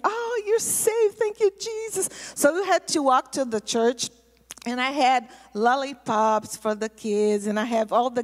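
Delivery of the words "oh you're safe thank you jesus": imitated relieved cry. Girls finding their pastor safe, imitated relieved cry. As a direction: imitate girls crying out in thanks.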